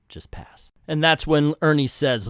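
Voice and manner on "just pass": whispered just pass. Real, not acted. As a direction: breathe the words unvoiced. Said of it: "same words said twice".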